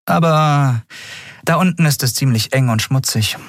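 calm and matter-of-fact; captain Andriel. Acted.

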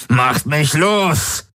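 high, drawn-out screech. A narrator's reading. Captive demanding to be released, screeching. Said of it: "kreischte er".

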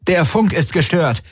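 high-pitched alarmed announcement; urgent calling. A narrator's reading. Tari reports the jammed comms, calling out urgently. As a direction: call it out high over the chaos. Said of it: "rief Tari".

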